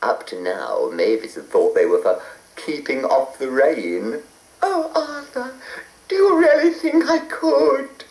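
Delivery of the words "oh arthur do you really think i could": high hurried fairy; a sweet high fairy in a rush. A narrator's reading. Hopeful fairy.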